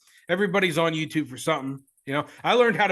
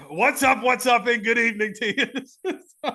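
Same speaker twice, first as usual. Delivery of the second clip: higher, louder announcer-style welcome; hammed-up intro greeting; 6 semitones higher, 3 dB louder than usual. Host mimicking his own show opener, hammed up. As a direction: boom it like a radio host opening the show.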